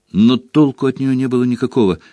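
ordinary delivery

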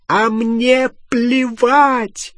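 high, loud, stretched wail of rage; furious scream. A narrator's reading. Hermione dismissing Harry's defence of Ron, screaming. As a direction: wail each word out slowly. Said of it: "завопила Гермиона".

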